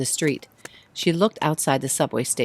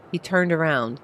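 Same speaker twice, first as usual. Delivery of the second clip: plain reading voice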